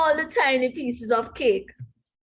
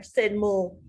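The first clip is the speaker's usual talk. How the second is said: usual speaking voice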